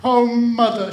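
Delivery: put-on high, loud childish wail; acted sob, pitched right up; sobbing. A narrator's reading. Child running to mother, sobbing. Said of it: "she sobbed".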